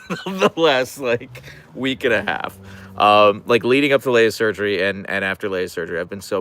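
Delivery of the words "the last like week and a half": laughing. Real, not acted. Laughing at herself.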